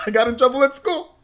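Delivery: loud, high cartoon bear through laughter; hammed-up cartoon bear confession; Yogi Bear. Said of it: "Talking like Yogi Bear".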